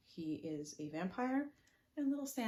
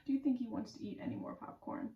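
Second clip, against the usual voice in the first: ordinary delivery, nothing marked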